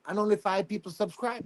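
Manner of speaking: ordinary delivery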